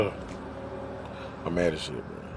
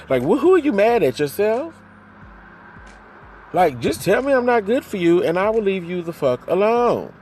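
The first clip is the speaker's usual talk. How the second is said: high, loud exasperated demand; heated yell; 10 semitones higher, 5 dB louder than usual. Real, not acted. Daring her boyfriend to end it, loud and high. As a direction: shout the challenge at him. Ranting at absent partner.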